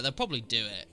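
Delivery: normal talking voice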